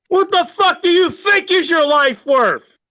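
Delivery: a slow, bellowing shout; furious shout. Acted. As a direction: yell it at the top of the rant. Climax of rant.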